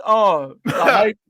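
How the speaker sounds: normal talking voice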